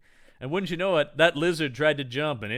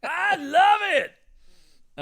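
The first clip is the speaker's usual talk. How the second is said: very slow, high, shouted ape cry; drawn-out chimp cheer; 4 dB louder, much slower than usual. Pretend. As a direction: stretch it out like a thrilled ape.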